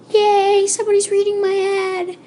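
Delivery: high-pitched, gleeful "yay" squeal; excited cheer. Acted. Babysitter cheering to self that somebody read the ad, excited. Acted excited thought about the ad.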